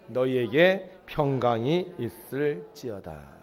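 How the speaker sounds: very slow, measured benediction; solemn blessing. Acted. Jesus blessing the disciples, very slow and solemn. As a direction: bless them slowly and gently.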